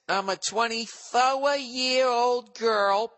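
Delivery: put-on high, slow, drawn-out young woman voice; high mock girl voice. Reading her letter aloud, in a slow high girly voice. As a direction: stretch it out like a bubbly young girl. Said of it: "She says".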